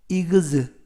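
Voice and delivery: 이그즈 said slowly as a separate gz sound; pronunciation demo. Shown as a demonstration. Teacher isolating the 이그즈 sound, careful. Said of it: "이그즈 발음이 납니다".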